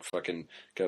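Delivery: ordinary talk, nothing marked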